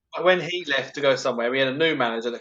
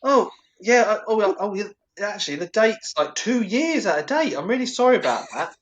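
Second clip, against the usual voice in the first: flustered apology; 6 semitones higher than usual. Retold or imitated. Retelling apologising to customer.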